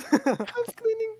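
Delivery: put-on very high, drawn-out Mexican maid accent; mock maid accent. Podcaster repeating his maid gag, high Mexican maid voice. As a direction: sing out slowly in a squeaky mock maid accent. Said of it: "I sound like I should be saying".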